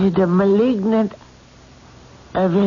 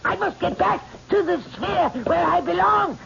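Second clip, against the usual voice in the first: terrified screaming; 11 semitones higher, 5 dB quieter, faster than usual. Acted. Medium possessed, screaming to escape.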